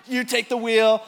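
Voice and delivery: high, loud command; urgent order. Retold or imitated. Pastor retelling ordering buddy to steer, loud and urgent. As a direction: bark the order over the motor.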